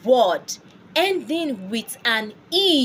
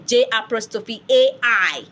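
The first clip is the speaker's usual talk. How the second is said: ordinary talk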